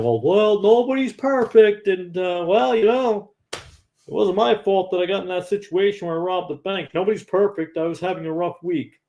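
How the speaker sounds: high whiny mock excuses; mock excuse-maker. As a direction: parody someone dodging the blame. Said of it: "we use that whole line".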